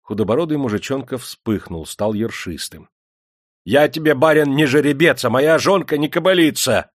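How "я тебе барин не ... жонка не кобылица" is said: high, loud indignant retort; raised, defiant protest; angry outcry. A narrator's reading. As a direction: cry out in anger at the master. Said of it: "гневно выкрикнул он".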